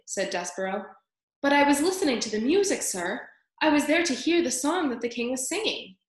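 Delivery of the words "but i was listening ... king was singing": higher, eager explaining; earnest young mouse. A narrator's reading. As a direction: plead innocently to the elders.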